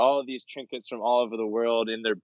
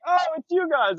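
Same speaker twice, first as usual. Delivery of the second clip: high, delighted recognition; squeaky high-pitched pleased oh; happy surprise; 3 dB louder than usual. Retold or imitated. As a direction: greet old friends in glee. Recognizing the couple at the hull.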